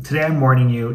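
usual speaking voice

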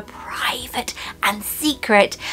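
drawn-out, hushed mock confidence; mock secretive whisper. Presenter teasing her private fan group, mock secretive whisper.

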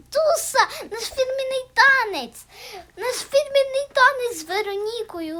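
high, loud excited squeal. Real, not acted. Радіє танцю в грі. (радісний вигук)

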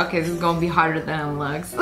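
low, fast, hushed realization; a hint of a nervous cat thinking aloud; worried cat's thought. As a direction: rush it under your breath as the cat sees the drop. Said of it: "he realized when he got up there".

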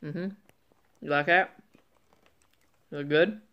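biting into a wafer; talking while eating; mouth full of wafer. Real, not acted. Seen 0: Man holds wafer against lips, apparently biting while asking.

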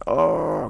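loud, high angry "oh"; mock angry groan. Batter hit by the pitch, mock angry groan. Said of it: "getting angry".

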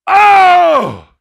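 loud hurt yell; pained yelp. Retold or imitated. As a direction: cry out like you got hit. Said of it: "Mike Canada go".

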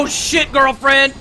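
high, loud exclamation; startled outcry. Real, not acted. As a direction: cry out loudly, drawn out and startled. Startled outcry during play.

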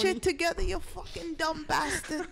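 high, scolding Italian-American grandma impression; strong Italian-American accent. Imitating Italian grandma cursing someone out, in a high Italian-American accent. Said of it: "That's literally how they all talk".

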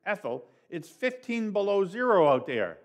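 indignant dad booming back; thundering father. A narrator's reading. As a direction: boom out the protest at the cold. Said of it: "my father thundered".

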